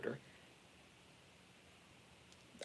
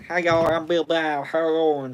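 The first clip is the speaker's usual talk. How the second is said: louder Southern Billy Bob; Southern accent; 6 dB louder than usual. Shown as a demonstration. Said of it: "like a real, real Southern accent".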